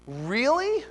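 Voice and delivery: high rising disbelief; disbelieving really. Real, not acted. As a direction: ask it like you can't believe it. Incredulous repeated really.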